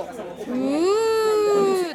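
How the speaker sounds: long, high siren-like "ooo"; imitated siren wail. Recalling what was heard at night while studying, imitated siren. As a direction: draw out a rising wail like a distant siren. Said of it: "サイレンなんなかった".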